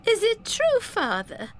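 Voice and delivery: put-on high, hurt girl's question; young Effie. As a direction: ask her father, shaken.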